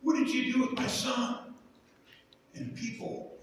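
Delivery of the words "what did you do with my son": high pleading lament; imitating crying God. God calling to the people over his son, mock-crying. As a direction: mimic a grieving father crying out, pitched up. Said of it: "he comes out and he's crying".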